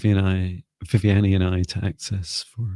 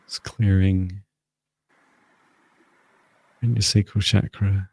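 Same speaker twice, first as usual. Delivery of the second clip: guided healing voice. Acted. Energy healing, guided session.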